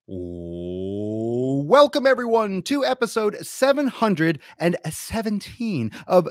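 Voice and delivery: high, loud drawn-out intro; over-the-top ring-announcer energy; hype show announcer. Acted. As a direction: bellow a muscle-bound podcast welcome.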